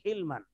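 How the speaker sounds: حلمن said "hilman", with a short i; i after the H. Shown as a demonstration. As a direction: say حلمن with kasra on the ح. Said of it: "ہا کی زیر کے ساتھ". (حلمن کو حا کی زیر سے "حِلمن" پڑھیں)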